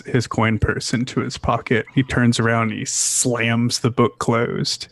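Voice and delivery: ordinary delivery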